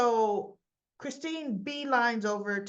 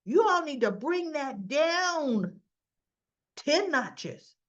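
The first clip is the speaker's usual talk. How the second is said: scolding; 5 semitones higher, 5 dB louder, slower than usual. Retold or imitated. Summer scolds arguing women.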